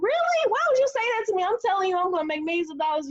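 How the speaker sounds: high, hurt and incredulous, easing down by the end; retold shock at a brother's putdown; indignant disbelief. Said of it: "I'm like".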